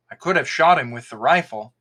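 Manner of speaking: plain reading voice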